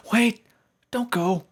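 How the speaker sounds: high, loud pleading shout; high shouted plea; desperate call. Acted. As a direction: call them back as they fade. Friends fade, pony calls out.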